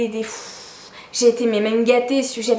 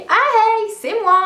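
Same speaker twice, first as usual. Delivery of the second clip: high, bright mock-casual hello, much higher and louder; breezy dad greeting; 8 semitones higher, 3 dB louder, slower than usual. Imitating the absent father cheerily announcing himself on the phone, high and loud. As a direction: play the long-lost father chirping down the phone like nothing happened. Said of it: "ton père qui t'appelle et qui te dit".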